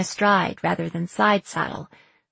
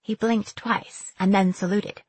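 ordinary delivery

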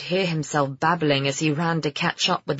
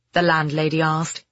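ordinary delivery, nothing marked

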